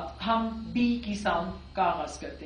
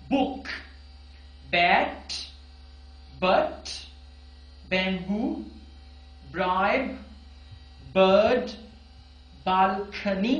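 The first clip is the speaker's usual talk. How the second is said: clear drill words; 3 semitones lower, slower than usual. Shown as a demonstration. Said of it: "मेरे साथ repeat कीजिएगा".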